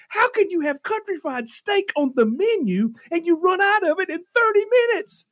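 high-pitched outraged demand; acting out indignation at the waiter; freaking out. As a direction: climb into incredulous ranting. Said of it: "like, freaking out".

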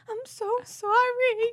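very high, loud mock-pleading apology; retold apology in a high squeal; imitated apology. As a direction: wail out a hammed-up sorry. Acting out her apology after crashing.

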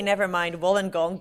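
ordinary talk, nothing marked

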